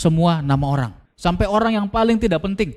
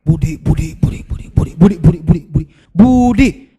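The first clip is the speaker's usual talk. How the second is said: repeating to memorize. Shown as a demonstration.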